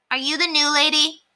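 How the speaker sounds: put-on high, curious child's voice; a small girl bluntly asking; little girl asking. A narrator's reading. Ramona asking the new neighbor, in a high child's voice. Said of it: "asked Ramona".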